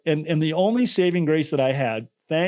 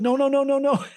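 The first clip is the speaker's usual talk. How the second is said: high, panicked no's, mostly for laughs; mock panic. Wife hands the phone to the husband, hammed-up high panicked refusal. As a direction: recoil in comic alarm, way up in pitch.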